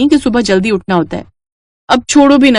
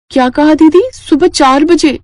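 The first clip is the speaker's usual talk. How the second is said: shocked; 7 semitones higher than usual. Acted. Roshni shocked at 4am wakeup.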